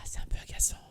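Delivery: whispered aside; whispering. Real, not acted. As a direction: breathe it with no voice. Annoyed aside during word game.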